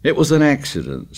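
high, stubborn insistence. A narrator's reading. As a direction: dig in and insist. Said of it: "said Piggy stubbornly".

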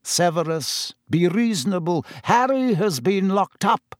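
soothing official; hint of a worried minister; lightly voiced pleading minister. A narrator's reading.

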